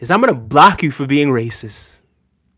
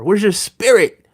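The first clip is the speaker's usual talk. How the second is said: ordinary talk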